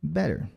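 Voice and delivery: BETTER with the T as a quick tap; flap T. Shown as a demonstration. Teacher models American English for learners, flap T. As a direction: tap the middle of "Better" like a soft D. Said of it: "Aqui nós temos o flap T".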